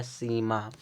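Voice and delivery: सीमा with the first syllable held as a long ee; long vowel sound. Shown as a demonstration. Teacher confirming the spelling rule, long ee in सीमा. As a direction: draw out the ee in सीमा. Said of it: "सीमा बोलने में long sound है ना".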